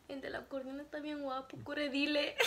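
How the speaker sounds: a soft, secretive confession to the aunt; hushed gossip. Retold or imitated. Whispering to her aunt about the handsome musician, hushed gossip.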